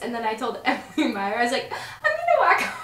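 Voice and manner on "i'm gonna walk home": high, laughing mock complaint; whiny quote, laughing through it; high mock whine. Retelling leaving the frat party.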